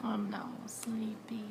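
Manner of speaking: soft slow whisper. Real, not acted. Son falls asleep after seizure.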